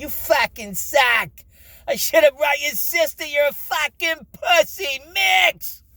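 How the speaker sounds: put-on high, loud hungover uncle yelling at a kid; retold screaming. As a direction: bark it like a furious boss at the cement mixer. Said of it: "he'd be screaming at you".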